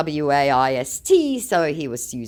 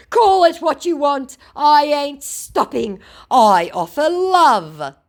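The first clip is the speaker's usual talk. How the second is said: mocking scammer; 13 semitones higher, 8 dB louder, slower than usual. Mocking the scammer's defiant reply.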